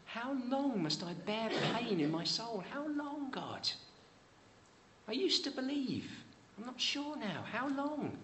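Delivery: high, aching plea, slowing on the last line; psalmist's lament. Acted. Preacher reading Psalm thirteen to the congregation, high aching lament. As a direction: voice the psalmist begging God for an answer. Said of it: "How many of us are saying that this week?".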